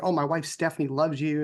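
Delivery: ordinary delivery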